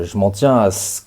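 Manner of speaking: usual speaking voice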